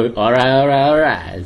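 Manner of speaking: slow drawled catchphrase, lightly imitated; Matthew McConaughey catchphrase. Host showing the star's signature line, slow imitation. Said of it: "ประโยคประจำตัว".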